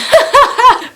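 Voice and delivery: loud squeal of a laugh; high burst of laughter. Real, not acted. Laughs at own gossip remark.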